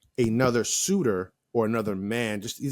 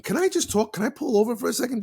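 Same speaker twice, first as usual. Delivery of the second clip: high mock flirty voice; 10 semitones higher than usual. Mock flattering woman quote.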